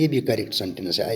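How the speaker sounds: ordinary delivery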